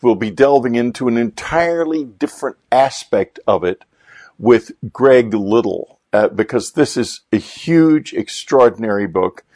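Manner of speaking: ordinary delivery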